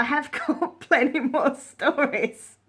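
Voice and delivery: slow, drawn out through laughter; real amused laugh breaking into the words. Real, not acted. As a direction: stretch it out while laughing. Laughs while promising more stories.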